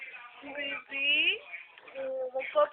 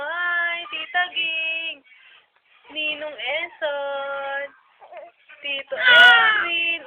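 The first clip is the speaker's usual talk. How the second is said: put-on greetings for baby; 9 dB louder, slower than usual. Parent prompts baby to greet relatives, calling out slowly. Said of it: "Sabi mo".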